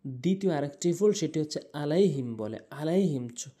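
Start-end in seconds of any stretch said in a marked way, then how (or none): 1.8-2.3 আলাইহিম with a plain glottal start; hamza for ain. Shown as a demonstration. Teacher showing most people's mistake, plain আলাইহিম. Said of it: "হামজা এনে উচ্চারণ করে". 2.8-3.2 আলাইহিম with a plain glottal start; hamza for ain. Shown as a demonstration. Teacher repeating most people's mistake, plain আলাইহিম. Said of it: "হামজা এনে উচ্চারণ করে".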